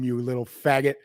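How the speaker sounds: ordinary talk, nothing marked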